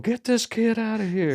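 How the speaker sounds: high-pitched imitation of a concertgoer calling out; bystander's call. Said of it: "some guy beside me going".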